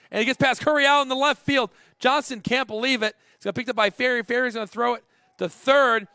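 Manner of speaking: loud, high-pitched live call; excited play-by-play. Real, not acted. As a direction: call the action urgently as it unfolds. Live call of error, run scores.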